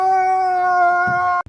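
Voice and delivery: loud, high, sustained scream; one long held "ah" yell, high and steady. Pretend. Goes down the slide screaming.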